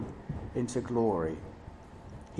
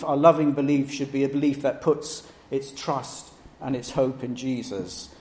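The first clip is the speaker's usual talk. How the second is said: ordinary delivery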